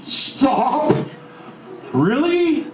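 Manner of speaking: high, sharp stop and an incredulous really; indignant stop. Real, not acted. Husband cutting off his partner's talk in high disbelief. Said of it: "I was pissed off".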